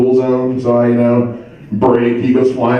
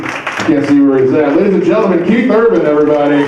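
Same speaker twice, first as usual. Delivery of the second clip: raised, high-pitched announcer voice; stage MC introduction; 7 semitones higher than usual. Real, not acted. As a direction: bring on the next act with rising hype. Introduces the next comic on stage.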